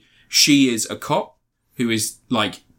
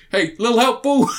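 high cheery call; 11 semitones higher, 3 dB louder than usual. Retold or imitated. Retelling comedy scene, character voice.

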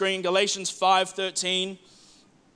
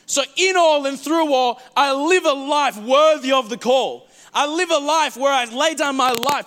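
raised, fervent preaching; rising preacher fervour; 5 semitones higher, 4 dB louder than usual. Acted. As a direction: lift it like a sermon climax. Preacher building up.

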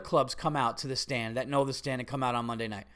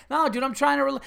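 high, louder mock objection; imagined protest; 10 semitones higher, 5 dB louder, faster than usual. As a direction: protest indignantly, voice pitched up. Acts the protest he didn't make.

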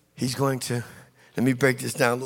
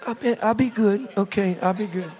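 put-on higher, small child voice; little boy promising; 5 semitones higher than usual. Little Jimmy answering his mother, small pleading promise. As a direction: promise meekly like a small kid.

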